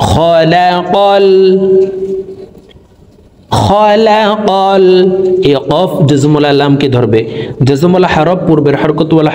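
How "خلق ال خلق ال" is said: high, slow melodic tilawat; full verse recitation. Shown as a demonstration. Teacher chanting the whole verse for students to repeat, high and slow. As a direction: sing out the whole verse slowly in a raised chant. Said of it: "সম্পূর্ণ আয়াত বলে দিচ্ছি".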